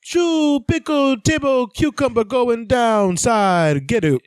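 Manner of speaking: high, slow mock Italian-accented English; imitating a crooner's fake English; fake English gibberish. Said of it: "he's like".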